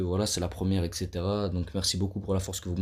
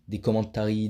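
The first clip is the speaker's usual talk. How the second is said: commentaries said the English way; playful English accent mid-sentence; 7 dB louder than usual. Pretend. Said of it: "Vous avez vu mon accent anglais?".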